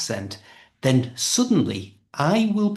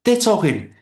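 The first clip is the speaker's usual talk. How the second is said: ordinary talk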